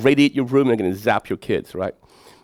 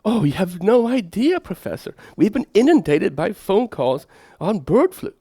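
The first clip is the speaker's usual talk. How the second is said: mock receptionist; 7 semitones higher than usual. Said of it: "they say".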